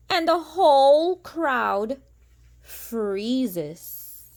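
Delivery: lilting storybook read-aloud; sing-song rhyming narration; reading to children. A narrator's reading. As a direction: read it like a bedtime picture book. Reading picture book aloud.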